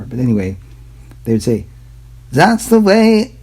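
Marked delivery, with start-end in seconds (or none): 2.3-3.4 high, slow put-on German accent; mock German accent. To viewers, a joke accent slipping into mock German. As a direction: slips into a hammed-up German accent by accident. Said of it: "I just went German".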